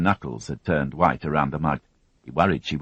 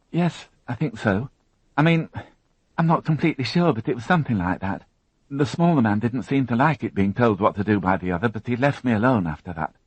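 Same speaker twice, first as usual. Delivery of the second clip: put-on higher, tense young woman recounting an ordeal; higher, strained witness voice; Alison, shaken young witness; 8 semitones higher than usual. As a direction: voice her as a frightened girl holding it together. Alison unsure.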